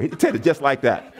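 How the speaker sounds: ordinary delivery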